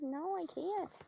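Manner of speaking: high, soft, timid horse voice; voicing the horse. Trainer voices the scared mare's refusal, high and soft. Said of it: "She's like".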